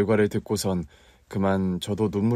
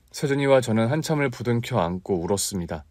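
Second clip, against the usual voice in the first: ordinary delivery, nothing marked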